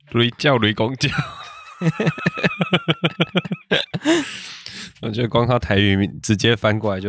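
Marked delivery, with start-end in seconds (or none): 1.8-5.0 high burst of laughter. Real, not acted. Laughing at Taiwanese name.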